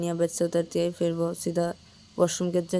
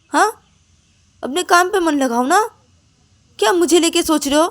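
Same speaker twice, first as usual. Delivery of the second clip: much higher, loud shrill scolding; furious outburst; 10 semitones higher, 11 dB louder than usual. Acted. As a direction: scream at her to leave you alone. Payal screams at Ananya.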